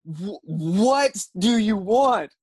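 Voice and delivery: slow, high, drawn-out exasperation; retold incredulous, strung-out challenge; exasperated demand. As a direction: stretch every word, at the end of your patience. Louder exasperated repeat of the demand.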